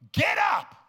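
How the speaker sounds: high loud command shout; Jesus commanding. Retold or imitated. Preacher voicing Jesus telling the man to rise, a high shout. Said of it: "He said".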